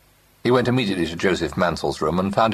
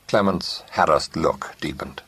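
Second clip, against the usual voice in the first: ordinary delivery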